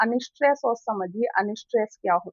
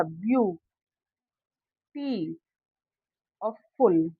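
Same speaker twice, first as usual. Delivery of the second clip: ब्यू, टी और फुल with a long gap after each syllable; broken into syllables; 4 semitones lower, slower than usual. Shown as a demonstration. Teacher recapping the syllables of beautiful, said piece by piece. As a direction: count out view ... टी ... और फुल, lower and separated. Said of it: "तोड़ के देखते हैं".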